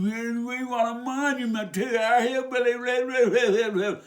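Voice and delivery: high, loud whiny mock complaint; mock whining protest. Hillbilly begging for a monument, mocking whine. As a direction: wail it like a hammed-up protest.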